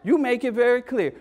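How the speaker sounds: ordinary talk, nothing marked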